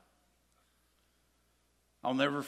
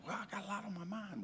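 whispered, higher excuse; mock excuse; 9 semitones higher, 6 dB quieter than usual. Husband excusing himself to his wife, whispered. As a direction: breathe the excuse out softly. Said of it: "I was like".

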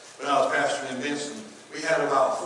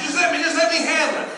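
much higher and louder, pleading to be trusted; mimicking worker; 13 semitones higher, 8 dB louder than usual. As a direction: mimic him begging to handle it. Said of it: "That's how he always".

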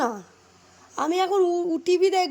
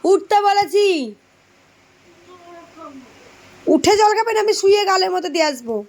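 higher, scolding call; scolding shout; 3 semitones higher than usual. Real, not acted. Mother scolding her daughter to get up, raised voice. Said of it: "সকালবেলা রোজ আমার এরকম চেঁচাতে হয়".

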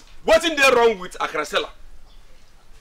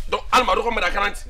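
normal talking voice

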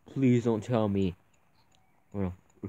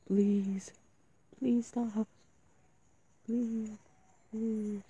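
pleading whimper; 7 semitones higher than usual. Real, not acted. Scared player pleading with horror game.

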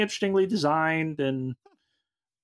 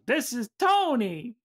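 slow, raised-pitch introduction; higher, stretched sign-off; going up; 6 semitones higher, slower than usual. Shown as a demonstration. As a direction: copy an upbeat lift, drawing it out. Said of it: "trying to go up with it".

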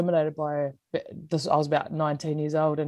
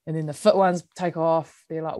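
ordinary delivery

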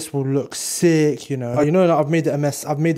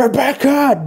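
high mock cry of a vampire; Klaus Michelson; 8 semitones higher, slower than usual. Vampire taking a heart out, theatrical cry. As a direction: call the name out, pitched up, in character. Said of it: "I feel like Klaus Michelson from the Vampire Diaries".

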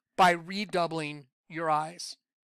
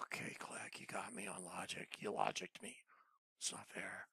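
hushed whispered pretend surrender; mock whisper; 12 semitones lower, 19 dB quieter than usual. Preacher to Click, playfully conceding in a whisper. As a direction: whisper it like a sore loser.

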